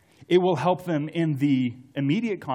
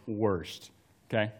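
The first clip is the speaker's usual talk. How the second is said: usual speaking voice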